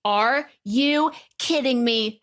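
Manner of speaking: choked up. Real, not acted.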